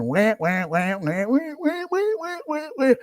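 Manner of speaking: mocking baby-cry wail. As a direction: imitate a wailing crybaby, pitch rising steeply. Mocking others' complaining as baby crying.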